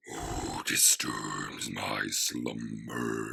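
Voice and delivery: very deep, very slow rumbling voice; Cave of Wonders voice. Shown as a demonstration. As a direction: boom it out like a giant stone cave. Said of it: "let me clear my throat and I can actually do that".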